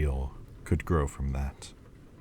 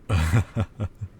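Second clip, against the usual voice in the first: short embarrassed laugh, higher and louder; self-conscious laugh; 3 semitones higher, 4 dB louder than usual. Acted. Demon laughing at his own rambling about dreams, embarrassed chuckle. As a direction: laugh off the awkward moment.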